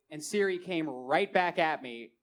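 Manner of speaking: ordinary talk, nothing marked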